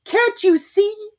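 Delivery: very high, slow squeaky shout. A narrator's reading. Piglet shouting at Kanga to look at him. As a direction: shout in exasperation. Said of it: "shouted Piglet".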